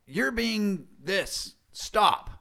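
slow, high, firm mock scolding; imitated exasperated parent telling off a kid. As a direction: draw out the accusation, then land hard on stop. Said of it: "Instead of saying, like".

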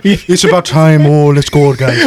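drawn-out mock Scottish rallying cry; slow, hammed-up Scottish impression; exaggerated Scottish accent. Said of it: "I give him a Scottish accent for no reason".

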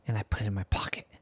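quick whispered confession; secret whisper. Acted. Boy telling his sister his trick, whispered.

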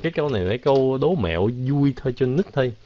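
normal talking voice

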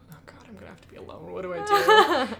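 breathless, rushed panicky whisper; panicked whisper. Retold or imitated. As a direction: whisper it fast like you're dreading it. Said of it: "I was panicking".

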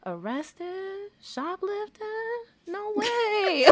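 high-pitched mock shock; incredulous disbelief. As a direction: squeak out total disbelief. Disbelief at arrest news.

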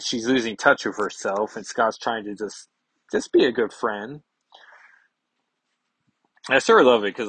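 ordinary talk, nothing marked

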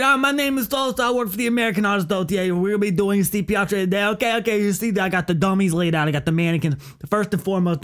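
high, rambling caricature of a class instructor; comic instructor with an accent; instructor impression. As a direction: rattle off the rules in a put-on high voice. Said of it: "he talks like this".

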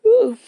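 loud, high open yawn; a big sleepy yawn. Real, not acted. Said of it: "excuse my yawn".

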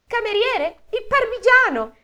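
very high, loud call to the waiter; shrieked order. Acted. The Sperelli calling the waiter across the room, high shout. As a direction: screech for the parmesan. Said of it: "urlò la Sperelli".

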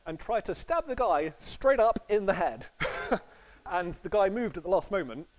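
ordinary talk

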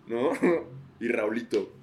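ordinary delivery

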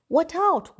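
high, loud warning call; mom warning. A narrator's reading. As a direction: call sharply to stop him. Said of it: "said Mom".

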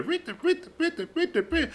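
high, soft squeaky creak noise; creaking imitation. Retelling a party story, imitating the creaking bed. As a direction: mimic a fast squeaky bed.

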